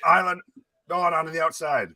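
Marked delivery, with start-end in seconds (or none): 0.0-0.4 broken off by a hiccup. Real, not acted. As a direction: hiccup mid-name. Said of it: "I fucking paused 'cause I hiccuped".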